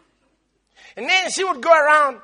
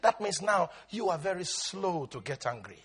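ordinary talk

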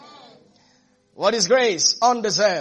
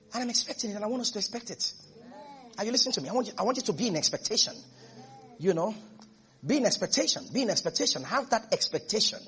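much softer preaching voice; hushed exhortation; 10 dB quieter, faster than usual. Acted. Preacher urging the church to be in expectation, hushed. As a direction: drop right down and confide it.

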